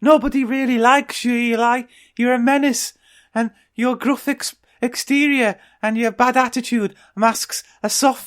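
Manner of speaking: high, silly duck voice, sustained; Dinky the Duck. Acted. Duck telling off the lonely cat, silly high voice. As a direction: deliver a character assassination in a high cartoon voice. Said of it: "Eli the cat and goes".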